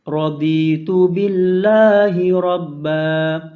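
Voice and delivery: ordinary talk, nothing marked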